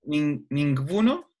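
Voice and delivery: "ninguno" slowly, G blended with B, questioning; G and B together. Shown as a demonstration. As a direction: try "ninguno" as ning-buno, rising. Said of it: "como una G con una B juntas". ("ninguno" con G y B juntas, lento)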